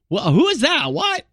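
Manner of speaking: high-pitched double take, leaping up on the last word; hammed-up squeak of disbelief; mock astonishment. Acted viewer's startled reaction to voice.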